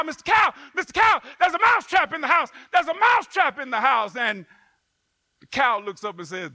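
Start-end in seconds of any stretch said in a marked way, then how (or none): 0.0-4.2 loud, fast, higher alarm call; frantic shout. Acted. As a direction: shout the warning at the cow. Mouse calling to the cow.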